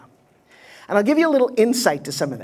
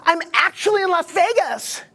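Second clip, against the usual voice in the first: mocking quote; 7 semitones higher than usual. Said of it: "Stop saying".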